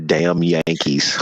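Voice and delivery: slow, drawn-out "Damn Yankees"; teasing Southern folk saying about Yankees; mock Southern grumble. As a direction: drag the words out like an old Southern grudge. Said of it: "us people from the South say".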